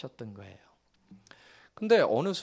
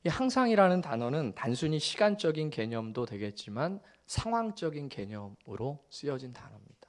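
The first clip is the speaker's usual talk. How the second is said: usual speaking voice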